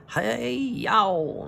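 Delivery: sudden high loud yelp; yelp of pain. Acted. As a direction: yelp as the girl bites your hand. Haddock bitten on the hand.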